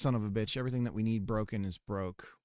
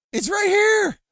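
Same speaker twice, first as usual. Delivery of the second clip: much higher, shouting it; frustrated shout; 13 dB louder than usual. Real, not acted. Streamer yells at the game that can't find the node, full shout. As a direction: yell it at the screen. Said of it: "it's saying it can't find it".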